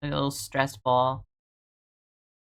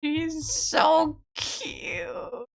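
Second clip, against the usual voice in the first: slow high coo; 13 semitones higher, much slower than usual. Real, not acted. Cooing slowly over her cute pet, high and drawn out.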